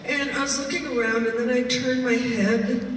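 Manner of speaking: ordinary delivery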